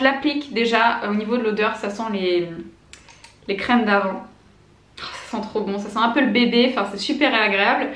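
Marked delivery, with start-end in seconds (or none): none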